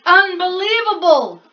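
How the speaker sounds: loud, high cry of disbelief; exasperated outburst. Acted. Bear finding the mouse again, loud and fed up. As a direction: explode at the pest again. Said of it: "rumbled Bear".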